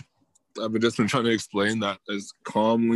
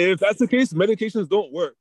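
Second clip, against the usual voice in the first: higher, louder exasperated argument; heated point; 6 semitones higher, 7 dB louder than usual. Real, not acted. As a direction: push the point hard. Heated point about meds.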